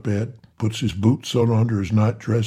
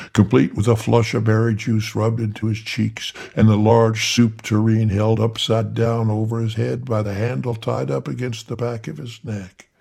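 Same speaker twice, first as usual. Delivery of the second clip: ordinary delivery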